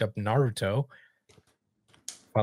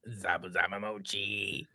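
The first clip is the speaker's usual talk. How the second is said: funny voice; 2 semitones lower, 7 dB quieter than usual. Pretend. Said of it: "I said it in a funny voice".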